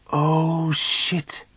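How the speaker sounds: high-pitched dismayed curse; a yelped oh shit; swearing. A narrator's reading. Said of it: "bander jeg".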